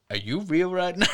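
lightly voiced high stoner wonder; spaced-out question; stoned disbelief. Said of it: "He's like".

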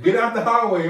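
high, loud imitation of a scolding grandma; grandma calling out. Grandma ordering neighborhood kids off the road, imitated holler. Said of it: "My grandma, um, she used to say".

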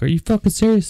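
high-pitched, incredulous question; retold incredulous uncle. Uncle reacting to news of the stalker, incredulous. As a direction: jump up high in disbelief.

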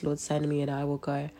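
ordinary talk

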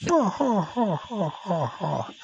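high giggle fading down; falling high mocking laugh; mock funny laugh. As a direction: imitate the coworker's laugh. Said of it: "And he laugh funny".

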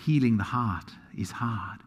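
hushed, whispered emphasis; a whispered truth to the congregation. Real, not acted. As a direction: drop to a whisper to make it land. Pastor stresses a key truth.

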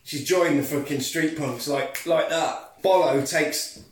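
normal talking voice

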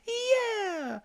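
very high-pitched cheer; exultant whoop. Retold or imitated. Peter still cheering after Falkor crashes, exultant whoop.

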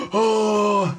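a sudden high "¡Oh!" to startle; mock scare shout. Dad in a mask jumping out to scare his son, mock yell. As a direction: jump out and yell at the kid. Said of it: "sale mi papá con la máscara de Scream, así como de".

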